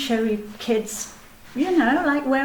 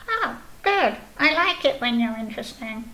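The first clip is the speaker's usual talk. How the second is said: Snail puppet voice. Acted. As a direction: play a chirpy snail puppet showing off.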